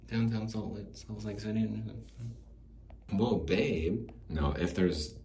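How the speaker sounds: ordinary delivery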